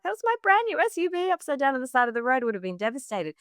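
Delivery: quick high hammed-up distress; imagined dismay. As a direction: play your own imagined meltdown. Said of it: "To me, it was just like".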